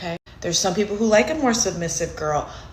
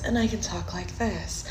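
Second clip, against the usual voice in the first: much softer, gentle and sweet; 8 dB quieter than usual. Shown as a demonstration. Coach showing guys the soft voice, soft and sweet. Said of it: "A lot of guys like that soft voice".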